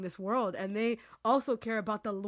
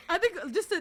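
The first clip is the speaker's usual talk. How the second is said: high embarrassed laugh through emotion; emotional little laugh; teary laugh; 11 semitones higher than usual. Real, not acted. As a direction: laugh it off while still moved. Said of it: "I'm getting emotional".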